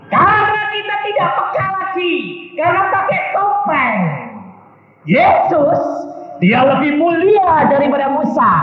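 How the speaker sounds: high, ringing sermon delivery; full-force preaching. Real, not acted. Preacher warns congregation about masks, proclaiming forcefully.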